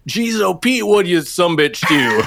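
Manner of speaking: lightly mocking Midwest twang, high then laughing; mixed regional accent. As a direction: toss off a hammed-up Cincinnati-Texas drawl, laughing. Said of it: "not only dropping the R's".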